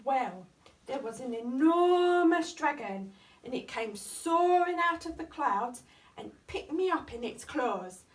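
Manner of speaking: usual speaking voice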